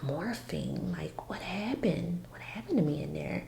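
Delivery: whispered, retold disbelief; hushed alarm. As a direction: drop to a near-whisper, questioning. Retells panicking on hearing of morphine.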